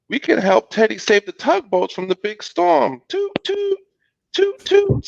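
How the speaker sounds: put-on high, eager child; little boy's voice. A narrator's reading. Little Bill's line in story.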